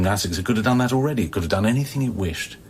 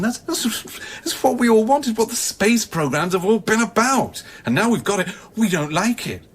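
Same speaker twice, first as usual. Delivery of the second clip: agitated; 12 semitones higher than usual. Acted. Agitated outburst about contact.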